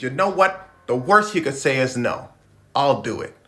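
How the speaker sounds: loud self-encouragement; pumped-up. Retold or imitated. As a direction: talk yourself into it out loud. Psyching himself up.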